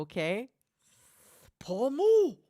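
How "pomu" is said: high, louder squeaky cry of Pomu; squeaky Pomu call. Acted. Streamer leads chat spamming her name in a high squeak. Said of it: "we're gonna spam Pomu".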